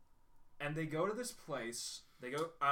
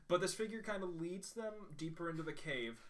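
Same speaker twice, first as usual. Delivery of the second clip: ordinary talk, nothing marked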